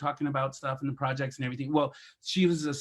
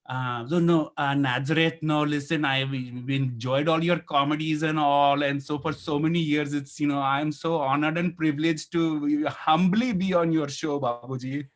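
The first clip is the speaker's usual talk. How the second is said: put-on respectful Indian-accented guest voice. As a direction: lay the Indian accent on thick and humble. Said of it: "you can do an Indian accent".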